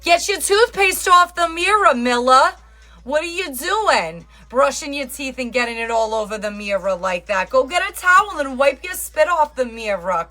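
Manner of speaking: high, loud nagging tirade; mock yelling. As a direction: scold your partner in a hammed-up rant. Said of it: "Was I yelling at you in it?".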